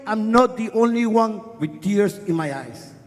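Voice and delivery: plain reading voice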